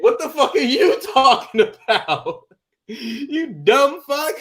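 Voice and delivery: high, laughing trash talk; mock outrage. Player trashing guy at bottom of list, laughing mock outrage. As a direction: berate them while cracking up.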